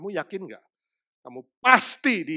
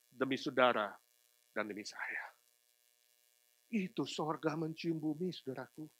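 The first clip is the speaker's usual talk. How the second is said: tender hush; 3 semitones lower, 11 dB quieter than usual. Acted. Preacher softly to congregation, tender low hush. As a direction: drop to a soft, low hush. Tender drop after climax.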